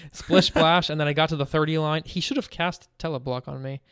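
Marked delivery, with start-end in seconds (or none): 0.1-0.9 laughing. Retold or imitated. Gloating to a friend about taunting a rival player, laughing. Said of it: "I said".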